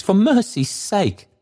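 high-pitched, frightened plea; Trotty. A narrator's reading. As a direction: cry out for mercy, pitched far up. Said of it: "cried Trotty, falling on his knees".

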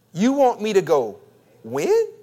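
loud setup, pause, "when" leaping very high; inflection on WHEN. Acted. Preacher voicing our pushback to God, incredulous. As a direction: hold back, then fling "when" upward in disbelief. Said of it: "put that inflection on the back".

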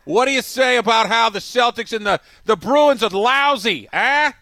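a heckling Boston fan, loud and high; Boston accent. Acted. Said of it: "This is a new voice".

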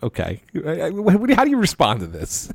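incredulous, rising in pitch as the words pile up; rolling your eyes at nonsense and sputtering; exasperated disbelief. Retold or imitated. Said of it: "rolling my eyes".

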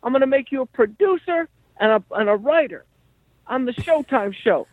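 high-pitched impression of a character manager; Henry. Manager handing his friend the producer job, high caricature voice. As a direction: jump way up into the old-school manager's voice. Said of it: "So Henry's like".

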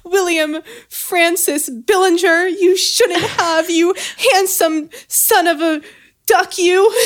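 high, slow swooning gush; swooning lady. Acted. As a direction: gush over him like a smitten maiden.

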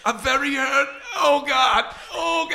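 very high, whining prank-caller voice; Saul. Retold or imitated. Caller pretending he fell down basement stairs, high mock agony. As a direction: wail in mock injury. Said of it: "pretends to fall down the stairs".

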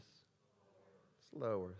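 soft, slow, hushed reply. Real, not acted. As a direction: barely say it, drawn out. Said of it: "We're getting a little quieter".

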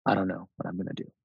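soft, subdued retold admission; quiet. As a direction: say it low-key and softly, imitating his uncertainty. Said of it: "he said it quietly".

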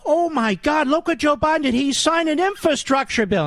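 high-pitched sarcastic awe; breathless fake fan excitement; mock gushing. Mocking gushing praise of Biden.

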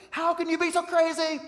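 high, loud mock outburst at culture; angry yell. Preacher mocking an angry believer, loud high yell. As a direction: yell it like you can't believe the world. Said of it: "We're like".

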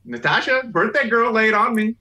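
high, loud excited calling out. Real, not acted. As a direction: hype her up loudly. Calling out to birthday girl.

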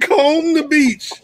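high, drawn-out quoted command; slow, high-pitched mimicked order; mock movie order. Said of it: "They said".

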